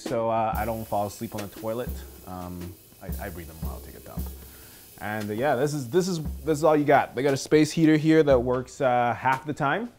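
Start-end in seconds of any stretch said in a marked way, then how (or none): none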